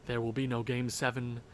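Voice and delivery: low, hushed statement; quiet first take. Shown as a demonstration. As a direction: say it softly to yourself. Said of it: "Wish it into existence".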